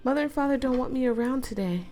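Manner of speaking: put-on high girl's voice, pleading; lightly voiced pleading girl; Emiko voice. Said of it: "Emiko voiced in story".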